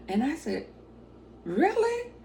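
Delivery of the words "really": high-pitched thrilled question; amazed disbelief. Retold or imitated. Teller offered a picture with the boss, amazed disbelief. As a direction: squeal it as an astonished question.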